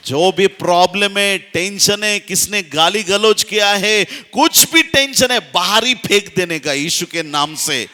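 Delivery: high, loud, building to a roaring shout; impassioned revival-preacher crescendo; full-force preaching. Real, not acted. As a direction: fire up the congregation with shouted declarations.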